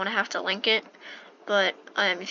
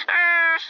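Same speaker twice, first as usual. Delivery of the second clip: short yelp; 9 semitones higher, 6 dB louder than usual. Real, not acted. Reacting to game scene.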